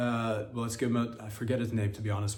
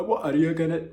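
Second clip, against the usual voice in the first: louder attempt that comes out Scottish; a mock accent sliding Scottish; accent turning Scottish; 5 semitones higher, 6 dB louder than usual. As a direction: aim for Nigerian, hit Scottish. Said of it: "just turns into Scottish".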